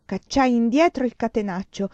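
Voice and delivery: usual speaking voice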